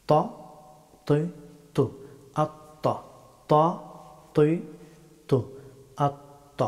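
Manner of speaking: "ত, তুই, তু" with a heavy, rounded t; full-mouthed Arabic ta. Shown as a demonstration. Teacher drilling the letter ta, heavy full-mouthed t. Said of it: "মুখ ভর্তি করে উচ্চারণ".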